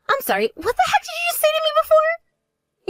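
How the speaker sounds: high, stunned disbelief; shocked outburst. Acted. Sister just told her boyfriend is the other's husband, shocked. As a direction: blurt it out in shock. Sister learns Jacob is married.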